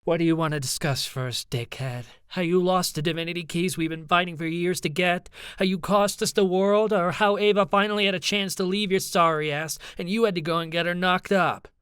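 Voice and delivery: angry accusation. A narrator's reading. Ivy lays into Charlie over his failures, angrily.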